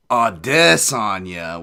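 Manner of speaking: "Adesanya" loud and drawn out, middle syllable overblown; overdone name pronunciation. Retold or imitated. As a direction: exaggerate and stretch the DES in "Adesanya". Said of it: "having a stroke halfway through the word".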